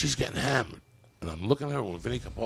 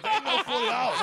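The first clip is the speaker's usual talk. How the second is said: high laughing mock protest. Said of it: "I'm like".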